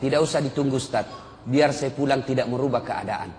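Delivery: low, soft, dismissive reply; understated, low-key brush-off; imitated indifferent son. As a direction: act out a son who will not come. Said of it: "Apa jawabannya?".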